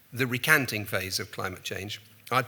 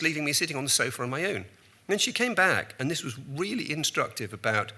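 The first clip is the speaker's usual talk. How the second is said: usual speaking voice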